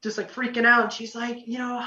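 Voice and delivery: normal talking voice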